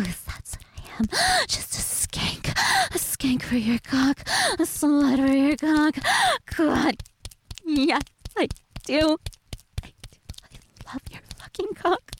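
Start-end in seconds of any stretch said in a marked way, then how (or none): 0.0-2.5 breathy whispered confession. Acted. Intimate roleplay confession. 9.8-12.1 soft higher whisper. Acted. Intimate roleplay confession.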